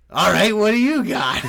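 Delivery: game for anything, laughing it out; laughing bravado. Retold or imitated.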